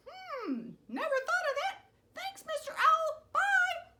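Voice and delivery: high, loud panicky rooster; a flustered little bird begging; squeaky rooster. Acted.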